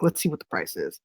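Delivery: low, quiet aside to himself; muttered aside. Retold or imitated. Quoting himself eyeing the price tag, low and quiet. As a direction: mutter it low under your breath. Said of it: "and I'm like".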